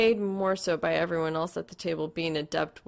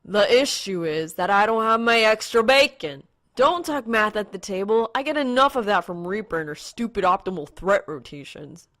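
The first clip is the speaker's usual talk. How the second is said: louder, higher petulant whine; loud sulky griping; whiny complaint; 4 semitones higher, 8 dB louder than usual. A narrator's reading. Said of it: "Blake whines".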